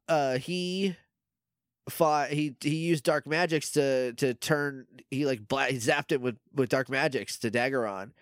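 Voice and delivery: normal talking voice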